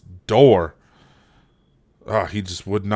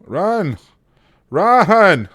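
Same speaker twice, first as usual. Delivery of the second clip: high desperate yells of run; frantic shouting; 9 semitones higher, 6 dB louder, much slower than usual. Real, not acted. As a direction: scream at him to run. Urging soldier to escape.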